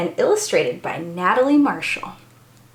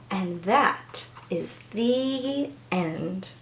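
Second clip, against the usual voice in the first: soft slow ending; 3 semitones lower, 8 dB quieter, much slower than usual. Acted. Closing the story.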